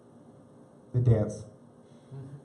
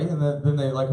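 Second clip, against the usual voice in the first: ordinary talk, nothing marked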